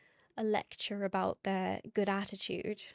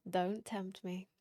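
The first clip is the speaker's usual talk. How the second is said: usual speaking voice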